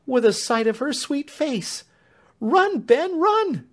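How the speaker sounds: high, loud urging cry; frantic woman urging Ben to run; urgent plea. A narrator's reading. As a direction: push him out the door with your voice. Clemency urges Ben out.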